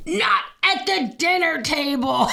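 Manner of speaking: high, slow scolding; stern dad. Retold or imitated. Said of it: "he would go".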